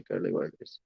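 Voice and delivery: quietly repeats curly whirlies under breath; soft repeat. Real, not acted. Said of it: "I'm sorry".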